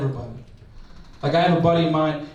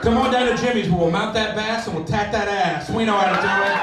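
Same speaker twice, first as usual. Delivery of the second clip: higher-pitched singsong commercial voice; local ad pitch; 4 semitones higher, faster than usual. Acted. Tattoo and taxidermy shop invites customers, in ad voice. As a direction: sell it like a small-town podcast spot. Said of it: "like, podcast spots".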